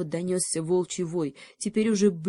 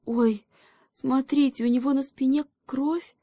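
higher, mildly alarmed call; startled, high-pitched alarm; frightened cry; 7 semitones higher than usual. Acted. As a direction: cry out in fright at a sudden sight. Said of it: "испуганно крикнула совесть".

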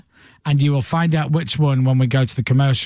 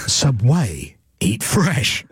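low ad tagline, "way" and "fresh" both emphasised; stress on way and fresh; 6 semitones lower, much slower than usual. Shown as a demonstration. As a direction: lower voiceover punching "way" in "Subway" and "fresh". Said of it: "with the emphasis on way and fresh".

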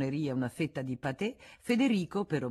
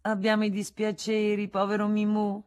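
high, louder, teasing pity; Rosanette teasing; 6 semitones higher, 6 dB louder than usual. Acted. Rosanette kisses Frédéric's forehead, high teasing pity. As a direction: coo at him like a pet. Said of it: "baciandolo sulla fronte".

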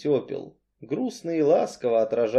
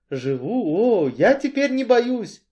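higher, lifting with relief; eager reply; 9 semitones higher, 3 dB louder than usual. A narrator's reading. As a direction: answer the protector eagerly.

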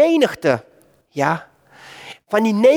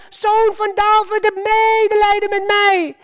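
calling out; 9 semitones higher than usual. Acted. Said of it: "hij roept daaruit".

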